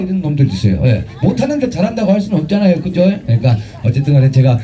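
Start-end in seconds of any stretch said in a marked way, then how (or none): none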